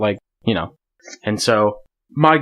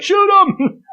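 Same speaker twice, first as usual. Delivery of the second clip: high-pitched, louder mock shout; urgent command; 5 dB louder than usual. Hunting partner urging him to fire at the bird, urgent. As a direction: imitate a buddy's frantic order, way up high.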